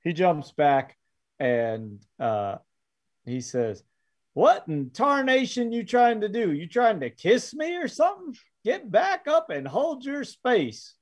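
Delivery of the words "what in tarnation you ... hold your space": put-on high, startled old prospector. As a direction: bark like a jumpy old miner. GM voices startled old miner.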